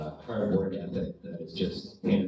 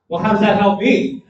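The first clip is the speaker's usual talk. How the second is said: retold indignant retort; 11 semitones higher, 12 dB louder than usual. Erin's retort, voiced quote.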